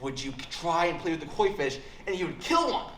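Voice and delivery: a hammed-up angry mom lecture; mother yelling. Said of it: "yelled at me hard".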